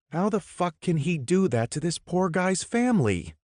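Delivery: high open disgust. Acted. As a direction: spit out the disgust. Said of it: "didn't try to disguise the disgust".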